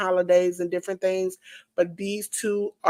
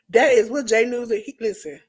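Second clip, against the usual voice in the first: ordinary talk, nothing marked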